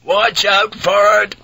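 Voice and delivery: high, loud, drawn-out call; shouted warning. A narrator's reading. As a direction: bellow a slow warning down the deck. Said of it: "Blackthorne shouted".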